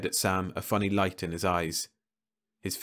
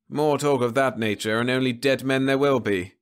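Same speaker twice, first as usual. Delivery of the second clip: higher, louder cold alien; a hint of calm menace; cool Venusian; 5 semitones higher, 5 dB louder than usual. Leffler demanding the radio from the crew, cool menace.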